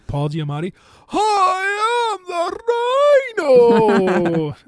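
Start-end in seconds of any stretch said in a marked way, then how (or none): 1.1-4.6 put-on high, loud, slow hammy Russian accent; bad Russian accent. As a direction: overdo a terrible Russian accent. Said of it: "has a bad Russian accent".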